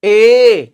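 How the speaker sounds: ए held long and loud; long ए vowel held; drawn-out e. Shown as a demonstration. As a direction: stretch the ए long. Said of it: "खींच के बोलेंगे ना".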